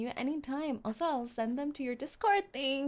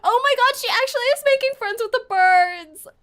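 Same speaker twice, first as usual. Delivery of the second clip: excited squeal; 10 semitones higher, 12 dB louder than usual. Real, not acted. Squealing at the cute scene.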